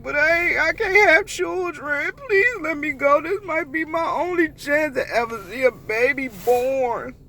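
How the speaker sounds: high, loud whiny begging; pleading mock voice. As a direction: beg like it's your only chance. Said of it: "I was like".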